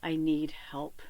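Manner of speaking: breathless whispered need help; whispered plea. Retold or imitated. Praying to Spirit in despair, whispered plea. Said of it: "I was crying to Spirit".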